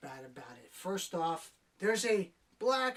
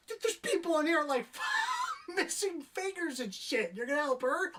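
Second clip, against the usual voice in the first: exasperated shout; 9 semitones higher than usual. Real, not acted. Reviewer ranting to viewers about triage, shouting. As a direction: yell in outrage at the absurd scene. Reviewer outraged at hospital scene.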